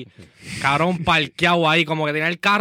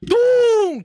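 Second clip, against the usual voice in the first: a sudden, much higher and louder "tum!" for the impact; crash sound effect; 5 dB louder than usual. Retold or imitated. To friends, retelling the crash with a loud mimicked bang. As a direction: bang out the crash like a cartoon thud.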